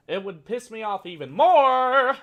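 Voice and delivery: yelling in mock outrage; angry yell. Real, not acted. Ranting at vegan flash mobs, loud yell.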